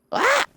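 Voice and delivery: sudden very high yelp of alarm; real startled scream; startled shriek. Real, not acted. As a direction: shriek as the car jolts. Startled by her car.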